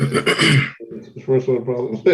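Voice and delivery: "It's first world problems." stretched very slow, leaning on "first"; drawn-out repeat. Real, not acted. Joking back to friends on the podcast, slow drawn-out repeat.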